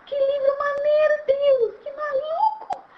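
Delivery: high delighted gushing; giddy wonder. Acted. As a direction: exclaim like a child seeing a pop-up book. Acts wonder at pop-up book.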